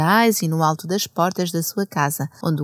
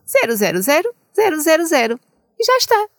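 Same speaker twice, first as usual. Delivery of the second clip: rising excitement; 9 semitones higher, 3 dB louder, faster than usual. Acted. Counting zeros for children.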